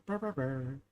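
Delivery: playful purring brr; silly copied trill. Shown as a demonstration. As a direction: trill a silly little sign-off. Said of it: "copying off other people, I go".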